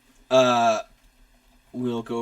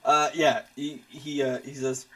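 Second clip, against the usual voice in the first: ordinary talk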